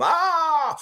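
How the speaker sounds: loud, very high strained "ah"; hoarse yell. Shown as a demonstration. Fan showing Tom his game-worn voice, hoarse high yell. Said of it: "my voice is hoarse".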